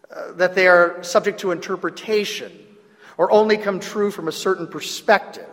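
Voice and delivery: ordinary talk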